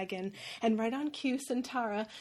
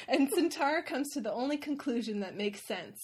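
usual speaking voice

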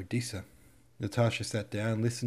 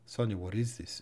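usual speaking voice

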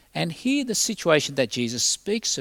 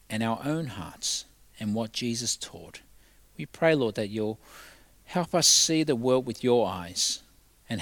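prayer; 4 semitones lower than usual. Real, not acted. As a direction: pray humbly on behalf of the congregation. Leads the congregation in prayer.

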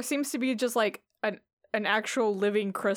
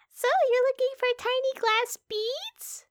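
high NPC voice. Acted.

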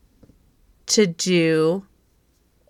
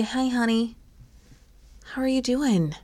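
soft, higher gentle check-in; softly coaxing, higher voice; comforting a child; 5 semitones higher, 6 dB quieter than usual. Acted. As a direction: greet her tenderly, as you would a small child. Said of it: "like you would comfort a child".

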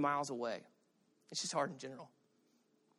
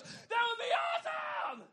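high cheering shout; crowd cheering; slower than usual. Retold or imitated. Connect group cheering at the wedding idea, high excited shout. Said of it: "Everybody goes".

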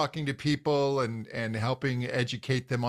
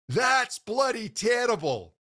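put-on Scottish accent; 9 semitones higher than usual. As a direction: blurt it out as a blunt Scottish father, higher than usual. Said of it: "That was a great accent".

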